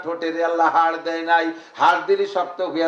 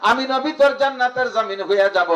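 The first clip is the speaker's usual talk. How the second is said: lightly raised sermon quote; prophet's words preached; 7 semitones higher than usual. Retold or imitated. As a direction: proclaim it like a promise. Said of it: "নবী পাক বলেন".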